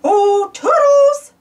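stretched calling voice. Acted. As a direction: call Toodles drawn out. Calling for Toodles.

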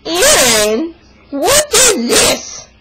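high-pitched mock yelling; mock outburst, pitch leaping up; imitated scream. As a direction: scream it like an outraged dad. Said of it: "He screamed at me like".

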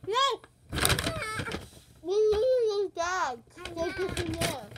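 high, loud, drawn-out protest; shouting. Real, not acted. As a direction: yell to stop her.

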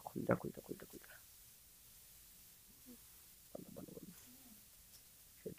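urgent whispering while hiding; breathless hushed pleading with a door; tense whisper. Real, not acted. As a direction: whisper it so the sweeper can't hear.

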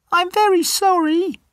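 very high, tiny mouse voice; squeaky mouse. Acted. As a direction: squeak it like a scared little mouse. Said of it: "says the mouse".

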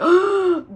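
sharp, high intake of breath; freaked-out gasp. Acted. Believers seeing a holy relic, awestruck gasp. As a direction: gasp in mock awe. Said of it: "they freak out".

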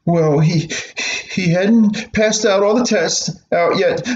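put-on a little boy showing his sore hand; whiny hurt boy. Boy complaining to mother about the teacher.

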